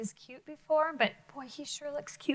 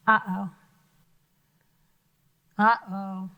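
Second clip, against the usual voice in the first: low, louder mock alarm; mock worried; 4 semitones lower, 6 dB louder than usual. Teacher reacting to the monster in mock fear. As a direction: play up the danger for the kids. Picture book suspense for kids.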